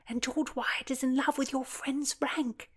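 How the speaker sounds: hushed high whisper; whispered plotting. Acted. Philomena plots against George in a hushed whisper. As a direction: keep it secret in the crowd.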